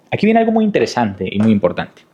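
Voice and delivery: usual speaking voice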